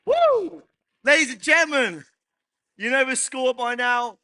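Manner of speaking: loud high announcer hype; hyped announcer. Real, not acted. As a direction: pump up the crowd like an emcee. Host opening panel with hype.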